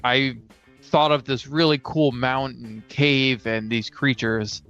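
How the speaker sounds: ordinary talk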